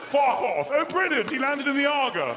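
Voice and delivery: high, loud posh exclamation; posh push-off. Acted. Posh man shoving intruder into the cooker, high posh cry. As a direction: crow loudly in a posh English accent. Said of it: "the Waitrose posh push".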